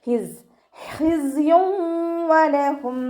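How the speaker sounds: ഹിസ് with the z buzzing like a bee, chanted high and slow; chanted ഹിസ്യും with a long bee-like buzzing z. Shown as a demonstration. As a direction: hum the z in ഹിസ്യും like a honeybee. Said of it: "തേനീച്ചയുടെ മൂളൽ പോലെയുള്ള sound".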